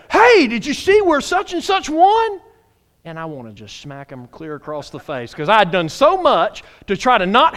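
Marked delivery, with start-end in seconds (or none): 0.1-2.4 loud, high, eager spoiler; excited blurting. Acted. Somebody at the meeting spoils the recorded game, mock excited. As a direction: burst in with the news, high and loud.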